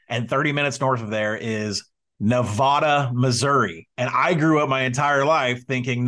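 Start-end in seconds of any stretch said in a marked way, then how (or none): 2.2-3.9 Nevada said nuh-VAY-duh or nuh-VAH-duh, unlike the state; local Missouri pronunciation. Shown as a demonstration. Host defending co-host with hometown example, local Nevada. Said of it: "is how they all pronounce it there".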